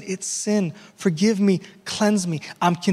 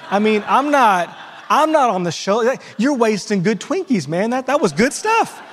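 joking rant; 5 semitones higher than usual. Real, not acted. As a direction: get worked up over wasted Twinkies, laughing at yourself. Comic rant about Twinkies.

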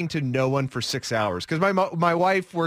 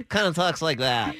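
nerdy cat voice. Pretend. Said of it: "Kind of a nerdy cat".

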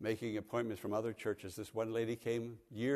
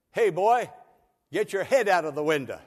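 put-on loud, high shout at the boy; driver yelling; 5 semitones higher, 10 dB louder than usual. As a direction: bark it across the bus.

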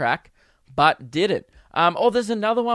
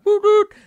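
two high, loud hoots; high cheering hoot; 8 dB louder than usual. Retold or imitated. Prankster cheering down the phone, high hooting. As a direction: hoot like a rowdy fan. Imitated cheer before hanging up.

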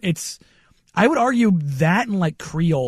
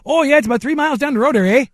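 put-on fast, higher, punchy Fargo accent; 7 semitones higher, 3 dB louder, faster than usual. McDonald's worker giving directions, in a Fargo accent. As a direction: imitate a staccato North Dakota Fargo accent. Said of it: "It was straight out of Fargo".

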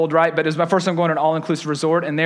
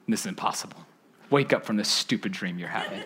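much lower and softer, thinking to himself; stunned inner voice; inner panic; 11 semitones lower, 9 dB quieter than usual. Retold or imitated. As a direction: mutter it in disbelief under your breath.

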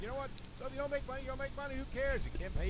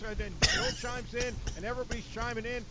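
ordinary delivery

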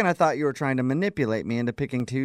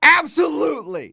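laughing shout, much higher; heated family shouting; 12 semitones higher, 6 dB louder than usual. Acted. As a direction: blurt it out, half laughing. Mock quarrel, laughed shout.

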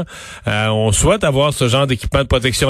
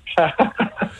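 brief chuckle, higher in pitch; short laugh; 4 semitones higher, 4 dB quieter than usual. Real, not acted. Interviewer after asking what step one would be, short chuckle. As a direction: let out a quick little laugh. Amused at own opening question.

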